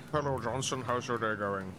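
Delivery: low, soft office-worker voice; a lightly voiced relaxed coworker sipping coffee; calm office worker voice. Gamer voices a calm coworker greeting colleagues, soft and low.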